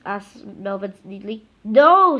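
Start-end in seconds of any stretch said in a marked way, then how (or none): 1.6-2.2 loud, sharp, high no; snapped no. A narrator's reading. Teacher snapping at the kids, loud and sharp. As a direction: bite the word off. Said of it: "snapped Miss Ribble".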